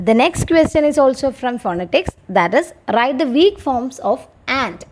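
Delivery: slow, measured reading of an exam question for students; slow dictation reading. Acted. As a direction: read it out slowly, word by word, like dictating to a class.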